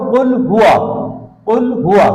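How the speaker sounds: কুল হুয়া with a full, long হুয়া; correct reading. Shown as a demonstration. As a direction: stretch হুয়া out clearly, not হু. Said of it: "তো আমরা কিভাবে পড়বো?".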